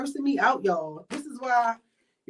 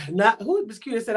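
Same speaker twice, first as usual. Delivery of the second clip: ordinary delivery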